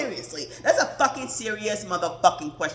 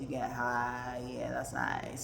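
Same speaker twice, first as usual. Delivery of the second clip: low soft deadpan trail-off; low mumble; 10 semitones lower, 9 dB quieter than usual. Real, not acted. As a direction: mutter it off to yourself.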